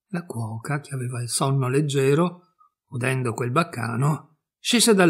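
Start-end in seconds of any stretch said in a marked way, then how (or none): none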